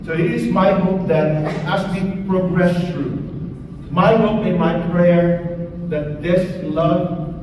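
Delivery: normal talking voice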